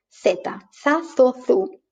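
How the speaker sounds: za, zo, zu with TH; Spain's TH. Shown as a demonstration. Teacher drilling za, zo, zu with a TH sound. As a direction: drill Z as TH before each vowel. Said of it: "we have the Z".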